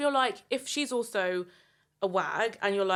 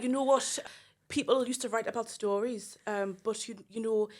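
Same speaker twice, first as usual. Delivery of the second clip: put-on Geordie accent; 3 dB quieter than usual. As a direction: put on Geordie, imitating a celebrity interview. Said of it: "my Geordie accent is actually really good".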